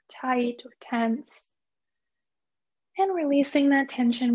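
calm, unhurried meditation guide with long pauses; guided meditation. Acted. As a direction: gently lead listeners into relaxation, phrase by phrase. Leads a guided mindfulness meditation.

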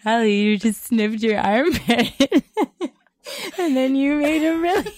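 high-pitched helpless laughing speech; barely getting the words out for laughing; cracking up. Real, not acted. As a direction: squeal it out through real laughter. Cracking up over sniffed armpit.

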